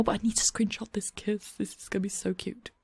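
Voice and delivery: soft unvoiced whisper; hush, don't spoil the moment. Real, not acted. Streamer whispering while screenshotting the kiss.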